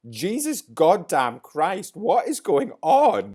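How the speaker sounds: high, loud, choked-up cry of disbelief; tearful outburst. Real, not acted. Crying over Leclerc's Monza win, tearful high outburst. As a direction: overcome with tears at the race win. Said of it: "Why am I crying?".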